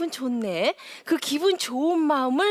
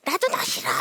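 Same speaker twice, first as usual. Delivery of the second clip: high, loud retort; mock angry mother; 9 semitones higher, 5 dB louder than usual. Mother answering her child, mock angry retort. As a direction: snap it back like a petty mom.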